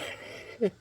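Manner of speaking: a sudden high, airy burst of laughter; high breathy laugh. Real, not acted. Laughing at the joke.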